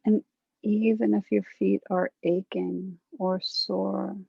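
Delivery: calm meditation guide, long pauses between phrases; guided meditation. Acted. Guide settling a group into a body scan, calm guided meditation. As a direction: lead them gently through breath and body, unhurried.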